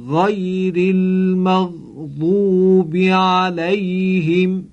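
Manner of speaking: غیر with a throaty Arabic gh, slow and drawn out; correct ghayn. Shown as a demonstration. Teacher shows correct ghayn to students, slow recitation. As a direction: say غیر المغضوب slowly with a true ghayn.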